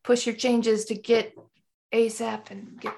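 tech bro voice; loud, pushy startup bro. Retold or imitated. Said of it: "my, my bro voice".

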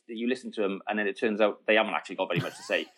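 usual speaking voice

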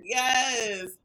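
shrieking laugh. Real, not acted. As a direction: burst out laughing. Laughing shout.

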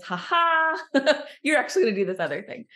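a lightly voiced amused God, laughing. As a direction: chuckle it out like a playful God. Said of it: "God laughs and says".

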